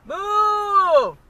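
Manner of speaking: raging yell; berserk rage. Real, not acted. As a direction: bellow it at the driver. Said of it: "Oh my goodness".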